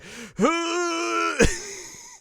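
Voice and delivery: long, high-pitched comic gasp; mock gasp. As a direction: draw in a loud, exaggerated gasp. Imitates Setsuna's shocked reaction.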